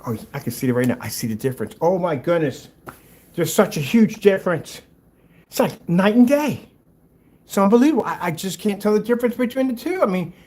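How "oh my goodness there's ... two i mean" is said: higher, louder gushing sarcasm; hammed-up fake awe; sarcastic. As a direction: overplay amazement mockingly. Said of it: "I'm being sarcastic".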